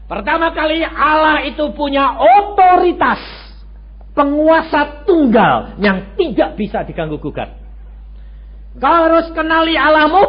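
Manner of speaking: high, loud, forceful sermon; loud preaching. Acted. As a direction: thunder the authority of God. Preaching God's authority. (khotbah berapi-api)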